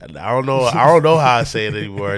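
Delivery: loud laughing protest; laughing loudly. Real, not acted. Laughing at own confusion.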